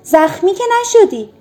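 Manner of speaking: high, alarmed calling down; frightened question. A narrator's reading. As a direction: ask in a panic if he's hurt. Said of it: "پدر وحشت زده پرسید".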